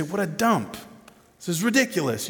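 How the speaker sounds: indignant. Pretend. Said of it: "You'd be indignant".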